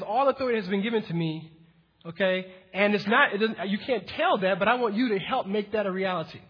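ordinary delivery

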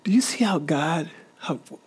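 hushed unvoiced whisper. Real, not acted. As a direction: lean in and breathe it. Preacher drops to a whisper.

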